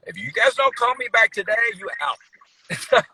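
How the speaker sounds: mock ultimatum. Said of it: "I said".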